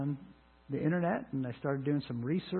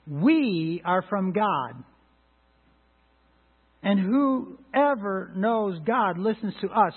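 slow, louder emphatic reading; slow, weighty Bible reading; scripture reading; 3 semitones higher, 5 dB louder, much slower than usual. Acted. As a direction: read each word of the verse deliberately.

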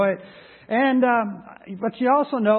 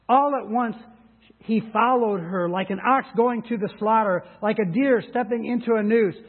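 raised, earnest Bible reading voice; scripture reading; 4 semitones higher than usual. Acted. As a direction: read it like a warning from the pulpit. Reads Proverbs passage aloud.

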